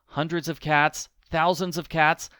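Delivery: ordinary delivery, nothing marked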